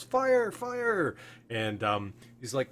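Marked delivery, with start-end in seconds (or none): 0.0-1.2 high-pitched acted shout of alarm; guard raising the alarm; yelled alarm. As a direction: cry out a warning to the building. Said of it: "and he yells".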